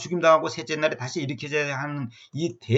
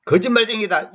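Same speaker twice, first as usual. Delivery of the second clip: mock name-calling; 6 semitones higher than usual. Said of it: "이렇게 말해도 무서운 욕인데".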